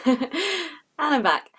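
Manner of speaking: sheepish laugh as the host comes back on air. Real, not acted. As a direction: laugh through the words, a little embarrassed. Host returns after a glitch, laughing.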